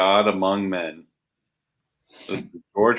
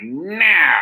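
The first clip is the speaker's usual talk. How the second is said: high, loud hammed-up refusal; exaggerated swooping cry; mock defiant yell; 9 semitones higher, 8 dB louder than usual. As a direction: act out a comic-book villainess refusing. Acted refusal in retold comic scene.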